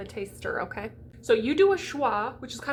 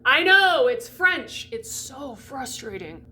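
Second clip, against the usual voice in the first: playful loud commiseration, tailing down slower; exasperated outburst; 2 semitones higher, 6 dB louder, slower than usual. Acted. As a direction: burst out in mock exasperation, then ease off. Mock outburst answering imagined student.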